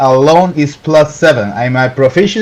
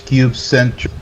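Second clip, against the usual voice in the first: ordinary talk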